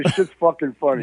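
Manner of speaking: normal talking voice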